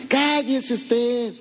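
slow, high, sneering scold; haughty big brother, very slow; mocking older brother. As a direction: drag it out to belittle him.

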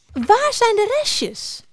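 very high, slow squeaky question; piepend. A narrator's reading. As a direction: squeak it out as an excited mouse. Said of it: "piepte ze nog eens". (heel hoog, langgerekt gepiep)

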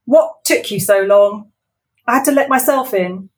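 loud, cold demand; villain boss grilling her worker; suspicious Wendy. Acted. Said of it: "she said, peering around suspiciously".

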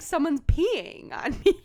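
mock outraged. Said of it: "I would be like".

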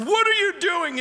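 high, startled wife's question; jolted awake, asking high and sharp. Retold or imitated. Wife woken at 3 am.

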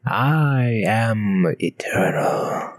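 slow breathy cosmic whisper. Acted. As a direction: intone it like an ancient being. Cosmic being intro.

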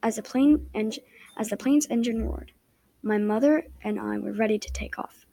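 ordinary delivery, nothing marked

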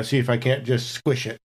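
usual speaking voice

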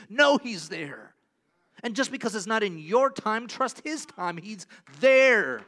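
loud, slow, emphatic assurance; preacher assuring the congregation, loud and slow; full-force preaching. Real, not acted. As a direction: preach it home with conviction.

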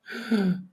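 gasp. Retold or imitated. Dramatic retelling of exclusion.

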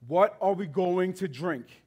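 slow, plain reading of the grumble; slow quoted question. Retold or imitated. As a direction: lay the question out slowly, barely acted. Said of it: "The people grumbled to Moses".

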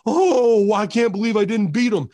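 hammed-up wrestler shock at a kick-out; mock disbelief. Mocks wrestler's formulaic kick-out reaction.

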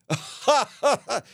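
high put-on cackle. Clerk laughs after teasing the guest, a high cackle. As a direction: cackle at your own joke. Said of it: "she'll say".

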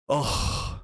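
usual speaking voice

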